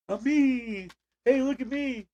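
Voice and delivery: voicing the dog. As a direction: play the dog begging for attention. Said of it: "I'm like".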